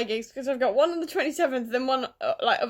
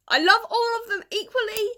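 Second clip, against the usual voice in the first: gushing; 9 semitones higher than usual. Real, not acted. Gushing about favourite Avengers.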